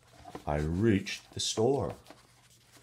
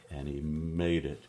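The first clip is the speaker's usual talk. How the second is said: usual speaking voice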